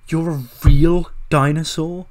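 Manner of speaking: high, loud, mock-amazed question. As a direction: ham up the disbelief. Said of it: "He's like".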